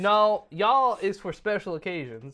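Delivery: put-on Southern accent. Correcting the co-host about y'all, Southern accent. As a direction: set them straight as a fussy Southerner. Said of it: "doing a Southern accent".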